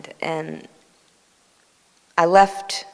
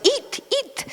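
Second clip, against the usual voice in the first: urging Baba. Retold or imitated. Baba urging them to eat.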